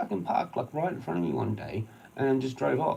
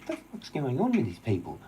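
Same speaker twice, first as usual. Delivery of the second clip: usual speaking voice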